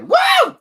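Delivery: loud excited whoop. Real, not acted. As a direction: let out a big whoop. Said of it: "Ooh, wow, that was loud".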